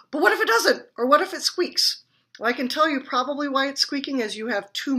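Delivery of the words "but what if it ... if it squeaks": mock alarm. Mock worried questions.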